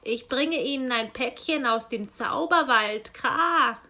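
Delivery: loud raven voice with harsh caws; croaking raven. Acted. Raven postman grumbles to himself, loud and cawing. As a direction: play the grumbling raven squawking Kra. Raven hands over parcel.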